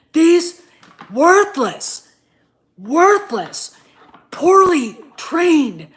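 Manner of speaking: a fan yelling at the directors in real fury; furious shout. Real, not acted. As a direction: scream your contempt at the filmmakers. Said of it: "but I'm pissed".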